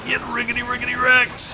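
high, silly trash-talk voice; hammed-up high-pitched gloating; mock taunting. Gamer jokingly telling someone to get wrecked, high mock taunt.

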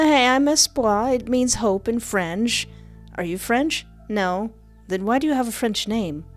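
ordinary talk, nothing marked

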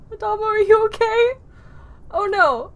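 high, loud worried call; alarmed concern. Real, not acted. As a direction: call out in alarm to check on a companion. Alarmed for hit companion.

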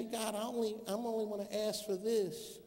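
hushed, hesitant request; timid prayer. Acted. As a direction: ask God small and quietly. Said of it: "and you say".

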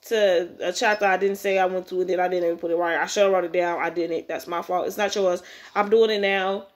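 ordinary delivery

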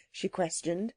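ordinary delivery, nothing marked

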